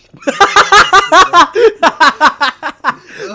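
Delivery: big high burst of laughter; loud cackle. Real, not acted. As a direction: crack up hard.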